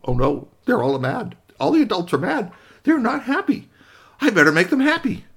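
eager toy wanting to fix things; high worried robot voice; worried little robot. Acted.

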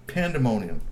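usual speaking voice

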